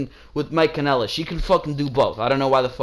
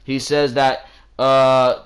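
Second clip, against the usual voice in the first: ordinary talk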